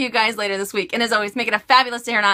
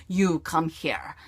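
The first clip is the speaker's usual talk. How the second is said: slow, lower, quieter put-on voice; [name]'s alter ego; 3 semitones lower, 5 dB quieter, much slower than usual. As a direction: slowly order the kids over in a put-on voice. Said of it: "I love doing those type of voices".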